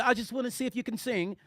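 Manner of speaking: soft mock British lady; British accent. Woman testing the young singer, mock British accent. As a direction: imitate her British accent softly. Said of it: "she had a British accent".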